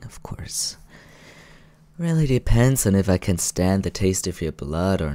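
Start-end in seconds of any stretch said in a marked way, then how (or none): 0.0-0.8 whispered afterthought; hushed teasing aside. Acted. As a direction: breathe it as a secret.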